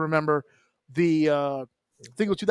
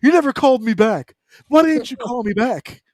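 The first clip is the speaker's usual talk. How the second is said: mock whiny girlfriend, a bit higher and louder; clingy girlfriend; 5 semitones higher, 6 dB louder than usual. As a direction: pout it out like a needy girlfriend. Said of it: "he just sounds like a, a clingy girlfriend".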